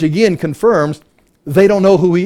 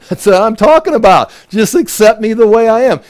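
high, louder, gloating demon; gleeful demon; 6 semitones higher, 5 dB louder than usual. Retold or imitated. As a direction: cheer it on as a pleased demon. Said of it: "that demon would be like".

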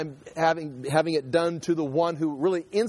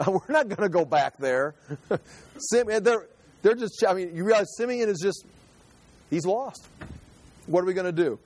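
usual speaking voice

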